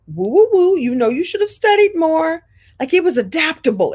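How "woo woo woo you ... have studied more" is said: a playful told-you-so in a high voice; mother gently scolding a poor test grade; teasing mom. Retold or imitated.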